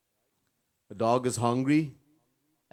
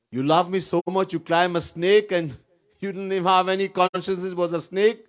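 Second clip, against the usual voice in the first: wife scolding; 6 semitones higher, 6 dB louder than usual. Retold or imitated. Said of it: "wife scolded him".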